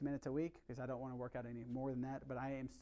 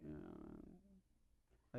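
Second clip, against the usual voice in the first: mock hesitant uh; 12 semitones lower, 16 dB quieter than usual. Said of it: "you're like".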